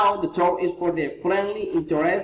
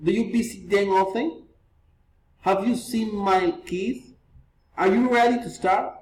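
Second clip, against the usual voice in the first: yes-no questions climbing at each end; teacher's model questions with upturns on "often", "kids", "start"; rising intonation; 2 semitones higher than usual. Shown as a demonstration. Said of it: "reason, intonation".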